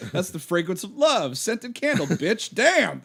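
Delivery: lightly voiced wannabe rapper; a hint of the rapper act, ending loud on Damn; quoting a movie character. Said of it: "He's like".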